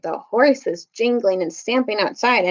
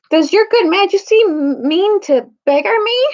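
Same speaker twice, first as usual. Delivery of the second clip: higher, pleading whine; whining; 9 semitones higher, 4 dB louder than usual. A narrator's reading. Pug begging the king, whining. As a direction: whine like a cornered merchant. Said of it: "whined Pug".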